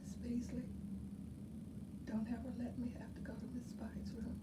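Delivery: retold secret whisper, fast and hushed. Teacher retelling a pupil's whispered plea, whispering. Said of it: "she whispered to me one day".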